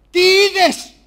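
booming host question, very loud; host demanding answer. Acted. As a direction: call it out to the whole studio. Host demands answer before audience.

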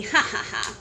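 low, soft "ha, ha, ha"; read-out laugh. A narrator's reading. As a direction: chuckle it out as a story character. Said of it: "laughed Stepney".

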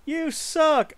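high-pitched jeering commenter; mocking commenter. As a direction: jeer it like an internet troll. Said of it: "in the comments going".

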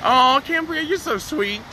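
high-pitched, sweet gush; gushing sweetly. Real, not acted. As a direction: coo it up high to someone adored. Gushing thanks to a viewer.

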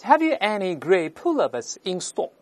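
slow British model sentence; British accent. Shown as a demonstration. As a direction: read it in careful British English, no r's. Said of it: "这英式发音".

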